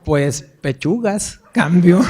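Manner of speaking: slow radio reply that ends in a laugh; laughing punchline. Retold or imitated. (respuesta por radio riendo)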